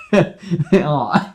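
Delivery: high, loud touched "aw"; overwhelmed by the moment; loud emotional aw. Real, not acted. Audience reaction, real.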